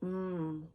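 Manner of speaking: "Mm" hummed long; M sound demo. Shown as a demonstration. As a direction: draw out "Mm" as a hum. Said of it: "has a mm sound".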